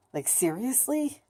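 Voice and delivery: high, slow, disbelieving question; exasperated rising question; incredulous. Retold or imitated. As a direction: stretch it out and rise high in disbelief. Disbelief at own drooling.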